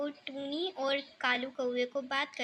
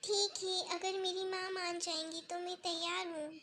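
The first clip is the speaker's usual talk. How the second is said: soft little bird voice, slightly raised; young bird agreeing; 4 semitones higher, 6 dB quieter than usual. Acted. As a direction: accept the proposal quietly as the young bird.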